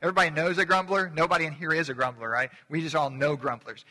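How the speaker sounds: ordinary talk, nothing marked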